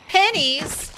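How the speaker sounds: over-the-top indignant surprise; mock outrage. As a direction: play it as fake shock. Mock outrage at low pay.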